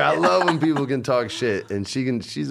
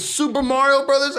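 very high mock outrage; incredulous question; 4 dB louder than usual. Man acting out his wife reading the shirt, incredulous. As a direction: imitate a wife in shrieky disbelief. Retold wife's outraged discovery.

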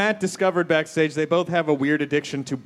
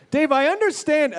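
talk show host; 12 semitones higher than usual. Shown as a demonstration. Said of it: "I don't think I did that right".

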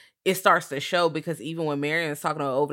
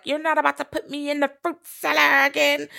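high, raspy-free demonic mother voice, louder; a hint of a possessed Deadite mother; a bit like a Deadite; 10 semitones higher, 4 dB louder than usual. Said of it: "She sounded like a Deadite a little bit".

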